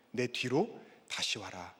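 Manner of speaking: low, slow, soft summons; hushed acted invitation; the Lord calling. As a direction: drop to a quiet, patient call. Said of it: "이렇게 부르시는 주님의 음성".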